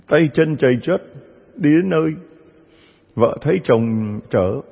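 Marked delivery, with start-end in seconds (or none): none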